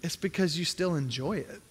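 hushed, weighty answer; quiet divine reply. Retold or imitated. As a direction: let God's answer land softly. Voice of the Lord answering.

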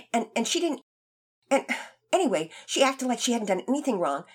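put-on high, rushed, stammering boy; fast stammer. Boy babbles his defense to the teacher, stammering fast. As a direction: trip over the words in a panic. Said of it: "spitting his words out so fast that he began to stammer".

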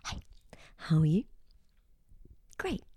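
whispered ASMR chat. Retold or imitated. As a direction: breathe it softly like an ASMR host. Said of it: "They talk like it's a conversation".

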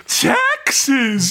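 high camp lion cry; hammed-up campy exclamation; Prince John. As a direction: draw it out with camp relish. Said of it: "the campest lion you ever did see".

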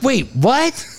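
mock bewilderment, jumping up high; high-pitched retold confusion; baffled retort. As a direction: act out a high, startled double take. Retells their own baffled reply.

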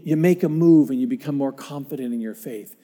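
ordinary talk, nothing marked

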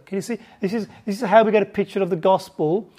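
usual speaking voice

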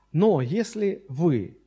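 usual speaking voice

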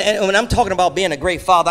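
ordinary talk

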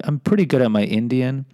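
ordinary talk

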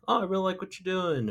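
high, quick voice of an admirer; admiring passer-by. Retold or imitated. Passers-by stop to compliment a muralist, high admiring voice.

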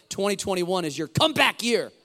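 loud rallying cry, building to the end; with conviction. Shown as a demonstration. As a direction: say it like you mean it, belting "comeback year". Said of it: "Say it with conviction".

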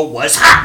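"Was hot" belted loud, like a group chant; girl band chanting "Was hot" loudly. Retold or imitated. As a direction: call out "Was hot" like the dancers. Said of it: "and they all go".

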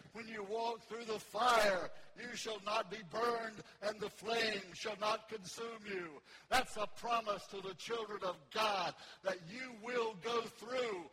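loud, slow, emphatic scripture proclamation; ringing sermon delivery, calling for amens; full-force preaching. Real, not acted. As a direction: preach the promise, leaning on every key word.

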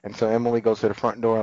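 ordinary talk

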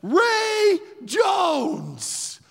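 huge drawn-out shout, high and loud; crowd yell. Retold or imitated. Fans in the stands cheering the tackler, yelling. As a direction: yell it like a whole grandstand. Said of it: "everyone yells in the stands".

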